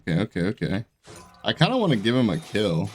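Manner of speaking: normal talking voice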